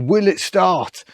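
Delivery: high, drawn-out put-on excitement; mock YouTuber hype. Car vlogger parodying proper YouTube suspense, mock-excited. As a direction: stretch it out like an over-hyped teaser. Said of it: "if I was doing, um, YouTube properly".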